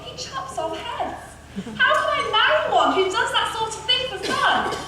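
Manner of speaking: high-pitched, troubled musing; Cindy thinking. A narrator's reading. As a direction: fret it aloud in a high voice. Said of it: "she thought".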